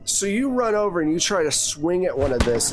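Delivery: normal talking voice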